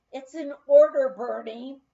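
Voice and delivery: loud, firm command; loud, firm order to a reckless dishwasher; emphatic. A narrator's reading. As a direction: lay down the law, loudly. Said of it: "I emphatically stated".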